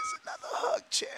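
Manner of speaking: very high, soft mock-tender voice; high teasing coo offering a hug; squeaky mock hug. Mock consoling rival fans.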